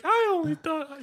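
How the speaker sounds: high-pitched put-on voice; quoted cartoon character. Quoting a SpongeBob scene, high cartoon voice. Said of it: "He's like".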